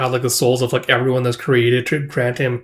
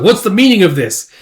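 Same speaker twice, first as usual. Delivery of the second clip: indignant demand; 7 semitones higher, 6 dB louder than usual. Acted. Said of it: "Captain's like".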